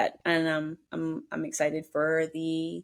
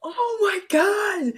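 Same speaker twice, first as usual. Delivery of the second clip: high, drawn-out gush; amazed; 13 semitones higher, 4 dB louder, much slower than usual. Real, not acted. Stunned by co-host's impression, high amazed gush. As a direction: gush in astonishment.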